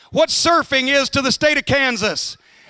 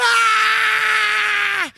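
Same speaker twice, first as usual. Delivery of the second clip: high, screaming cry of panic; retold panic scream; 10 semitones higher than usual. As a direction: shriek as the car slides sideways. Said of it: "I'm going".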